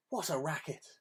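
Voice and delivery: plain reading voice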